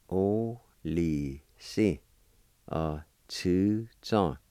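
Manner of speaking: "O li si, uh, two zong" spoken very slowly with each syllable separated; slow model pronunciation. Shown as a demonstration. Teacher modelling the word for grandson slowly for learners. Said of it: "First part is pronounced like this".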